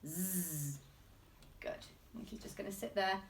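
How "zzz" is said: long buzzing z, teacher demo; buzzing zzz sound. Shown as a demonstration. Teacher modelling the Zzz sound for children. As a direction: hold the Zzz like a buzzing bee. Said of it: "My turn".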